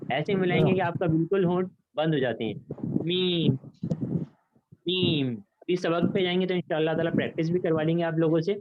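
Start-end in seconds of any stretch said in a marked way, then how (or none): 2.8-5.3 میم hummed with dry lip parts fully closed; letter sound demo. Shown as a demonstration. Teacher showing class how میم is made on closed lips, clear demo. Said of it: "ان دونوں dry والے حصوں کو آپس میں ملا لیجئے".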